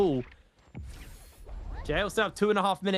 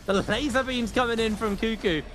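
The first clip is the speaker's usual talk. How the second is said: higher, excited esports commentary; excited play call; 6 semitones higher than usual. Real, not acted. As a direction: call the steal with rising excitement. Caster reacts to a big play.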